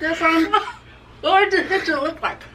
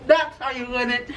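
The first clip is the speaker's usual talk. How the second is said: normal talking voice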